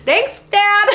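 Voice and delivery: high, loud cracking squeak; mock tearful thanks. Daughter thanking dad after the call, mock-tearful high voice.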